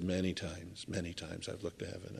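wistful hush. Retold or imitated. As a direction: let it fall away like a weary confession. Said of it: "the guy probably said".

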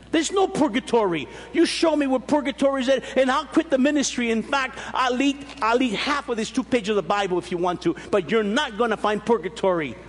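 mock angry challenge. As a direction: throw down the gauntlet like the angry young convert. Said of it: "See the voice, the tone of voice?".

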